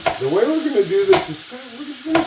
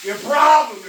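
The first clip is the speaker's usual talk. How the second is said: loud exclaim at the pan; loud call-out; 8 semitones higher, 3 dB louder than usual. Real, not acted. Calls out at the cooking.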